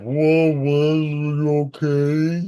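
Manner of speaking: drawn-out mock slow-mo voice; slow-motion talking. Podcaster imitates stunned onlookers after the fall, slow-motion voice. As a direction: stretch the words like slowed-down film. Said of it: "super slow and everybody's talking like".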